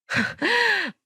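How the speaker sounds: short high-pitched derisive laugh; scoffing laugh. A narrator's reading. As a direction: laugh in disbelief at your husband. Wife scoffs at husband's honour.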